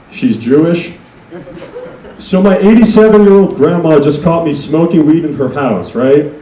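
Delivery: normal talking voice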